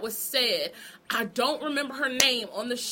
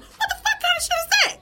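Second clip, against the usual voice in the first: high, loud, fast indignant squeal; outraged shriek; 5 dB louder, faster than usual. Real, not acted. To the guest who called women unstable, high outraged shriek. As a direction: fire it off in full outrage. Outraged at a cast member's comment.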